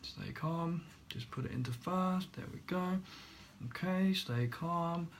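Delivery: soft, halting self-talk with long pauses; strained self-calming. Retold or imitated. Learner to himself while yelled at, strained calm. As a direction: talk yourself down quietly and carefully.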